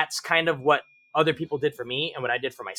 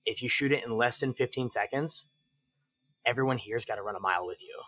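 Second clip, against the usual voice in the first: low, soft, measured threat; deadpan ultimatum; 4 semitones lower, 6 dB quieter than usual. Retold or imitated. Instructor giving a shooter the drill's penalty, low deadpan delivery. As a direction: lay down the stakes quietly.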